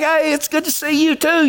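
high friendly reply; high, glad hey back; cheery greeting back. Retold or imitated. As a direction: answer the hail brightly. His own high greeting back.

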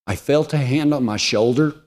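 ordinary talk

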